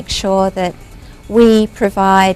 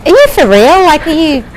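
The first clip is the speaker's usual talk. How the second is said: incredulous question; 8 semitones higher, 5 dB louder than usual. Retold or imitated. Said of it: "So it's like".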